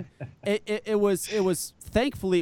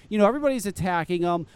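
ordinary talk, nothing marked

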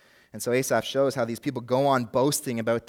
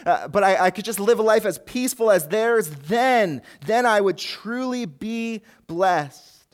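higher and louder, a yearning wish that slows at the end; envious longing; 8 semitones higher, 4 dB louder than usual. Retold or imitated. Preacher voices the envious believer, yearning and raised. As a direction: dream out loud of an easier life. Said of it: "We're prone to see their situation and just think".

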